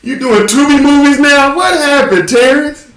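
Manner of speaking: high, slow mock dismay at a fallen star; mock pity. Teasing a friend about Tubi movies, in a high mock wail. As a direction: squeal it like a shocked fan. Mocking Terrence's career in dismay.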